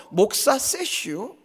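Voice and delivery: slow, drawn-out Chungcheong 세슈; Chungcheong dialect. Retold or imitated. Preacher repeating the elder's words, slow Chungcheong drawl. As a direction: mimic the elder's dialect, slowly. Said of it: "장로님 입에서".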